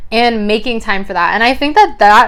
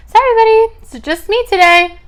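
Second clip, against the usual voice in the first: pet talk; 12 semitones higher, 5 dB louder than usual. Real, not acted. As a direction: coo an apology to the bunny. Talking to her pet.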